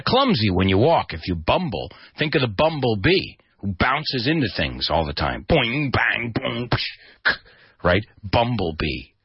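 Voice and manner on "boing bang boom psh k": cartoon boings and bangs; bouncing crash noises. Shown as a demonstration. Imitates a bee bouncing into things.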